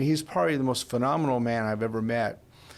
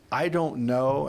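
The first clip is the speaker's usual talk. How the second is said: ordinary delivery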